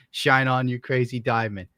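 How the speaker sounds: normal talking voice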